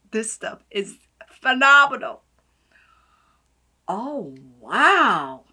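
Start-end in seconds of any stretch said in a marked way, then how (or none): none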